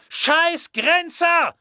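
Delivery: very high, loud, drawn-out yell; furious shout. A narrator's reading. Brother yelling at the border guards, furious shout. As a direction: scream the insult at full force across the street. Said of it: "hörte Rebecca ihren Bruder schreien".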